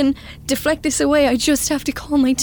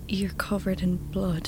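unvoiced hushed whisper; 3 semitones lower, 11 dB quieter than usual. Whispering to Val that she's bloodied. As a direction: breathe the horror quietly.

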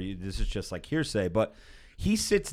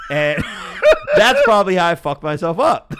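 loud laughing words, much higher; 8 semitones higher, 11 dB louder than usual. Real, not acted. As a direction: laugh through the confession. Laughing at his own mistake.

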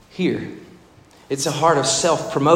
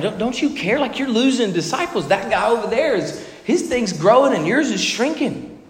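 needling poke; 7 semitones higher than usual. Retold or imitated. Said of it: "kind of like a, a poke at him".

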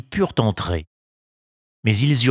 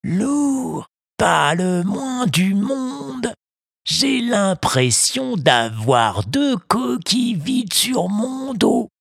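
big tuna; 11 semitones higher, much slower than usual. A narrator's reading. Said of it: "affirma le thon".

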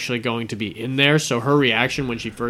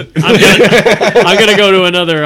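laughing through words; 7 semitones higher, 11 dB louder than usual. Real, not acted.

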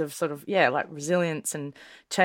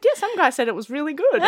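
high-pitched mum voice; laughing, high comic impression; imitated mum; 9 semitones higher, 4 dB louder, faster than usual. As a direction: play mum defending the sale. Imitating mum's reply, she said.

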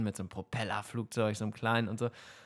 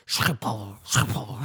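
Schiphol said slowly, mouth right on the mic; right in your ear; 5 dB louder, much slower than usual. Retold or imitated. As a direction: breathe Schiphol close into the microphone, slow. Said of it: "so ganz nah am Mikro".

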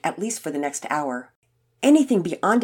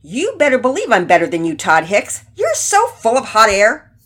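loud, higher-pitched quarrelling; angry retort; 5 semitones higher, 9 dB louder than usual. A narrator's reading. Finn telling Todd he is full of hot air, angry. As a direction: hit back hard at the braggart. Boys quarrel over tricks.

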